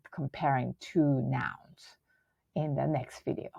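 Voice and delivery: whisper. Real, not acted. Drops to a whisper.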